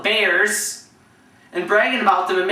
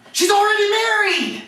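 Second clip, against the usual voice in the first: high-pitched, exasperated retort; exasperated outburst; 11 semitones higher than usual. Retold or imitated. Player answers teammate's repeated question about his sister, exasperated. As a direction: imitate losing patience and blurting it out.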